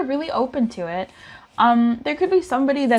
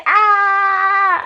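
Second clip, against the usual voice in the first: high, loud drawn-out wail; hammed-up freak-out scream; mock panic scream; 10 semitones higher, 6 dB louder than usual. Acting out both people panicking.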